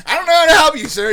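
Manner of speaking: high, very loud retold helplessness; exasperated reply. Teen answering the screaming stranger, loudly helpless. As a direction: throw it back loudly at the screamer.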